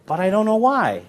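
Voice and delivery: mock the viewer who can't say why; impressed spectator. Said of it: "you go".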